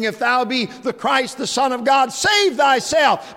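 preacher voicing the crowd's sneer at Jesus; mocking taunt. Preacher acting the sarcastic mockers, jeering and loud.

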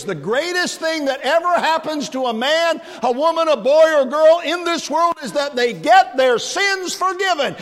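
high, loud preacher's cry; full-force preaching. Real, not acted. Preacher proclaiming to the congregation, loud and high. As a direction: ring it out over the whole church.

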